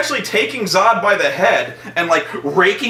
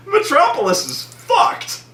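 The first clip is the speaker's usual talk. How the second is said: ordinary talk, nothing marked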